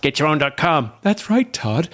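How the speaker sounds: high, lively podcast-host voice; Todd impression. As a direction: say it like an upbeat show host. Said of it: "where I impersonate, uh, Todd and Rob".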